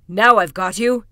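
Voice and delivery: loud cry through a keyhole; triumphant cry. A narrator's reading. Kuzma crying through the keyhole at the trapped fox, triumphant. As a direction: call it out loud, catching the culprit. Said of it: "he cried through the keyhole".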